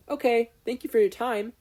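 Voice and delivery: put-on bright, eager young boy; polite high boy's voice. Boy thanking judges after exam.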